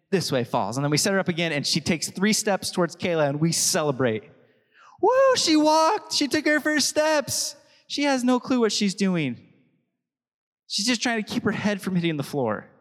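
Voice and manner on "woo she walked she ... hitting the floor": excited cheering. Retold or imitated. Parent cheering their baby's first steps, high and delighted.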